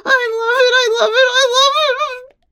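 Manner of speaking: high, urgent moaning cry; ecstatic cry. Acted. Wife carried away on the phone, crying out. Acted cries of pleasure on phone.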